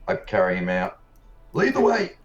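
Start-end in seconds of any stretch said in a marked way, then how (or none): 1.5-2.2 high, loud shouted command; urgent call. Acted. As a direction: call out to hurry the guide along. Player calls out, carrying wounded man.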